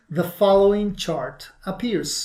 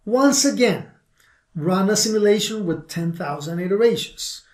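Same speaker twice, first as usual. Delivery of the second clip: ordinary talk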